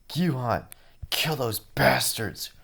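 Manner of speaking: high, loud shouted order; urgent command. Acted. Teacher urging Gyuhan to kill the attackers, shouting high and urgent. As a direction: urge him to hurry.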